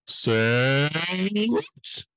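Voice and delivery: building announcer call. Acted. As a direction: build it slowly like a big announcement. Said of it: "the buildup is great".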